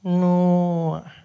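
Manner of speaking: loud, drawn-out "Noah"; slow clerk echo of "Noah"; unfriendly receptionist. Acted. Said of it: "She's not friendly at all".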